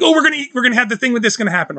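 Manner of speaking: fast acted-out enthusiasm; eager excitement. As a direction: race through it like a hooked viewer. Acting out getting hooked on movie.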